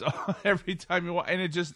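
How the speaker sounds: breaking into a laugh mid-sentence; laughing while talking. Real, not acted. Remembering Khadgar's endless line, laughing.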